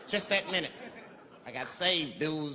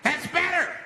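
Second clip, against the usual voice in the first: high, raised self-satisfied call; high commanding voice; 12 semitones higher, 4 dB louder, slower than usual. Retold or imitated. Man terrorizing the bus to hushed passengers, high call. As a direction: announce it high over the crowd.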